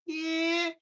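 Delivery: put-on squeaky little kid asking for breakfast; high kid voice. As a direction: pitch way up like a small child. Kid answering parent.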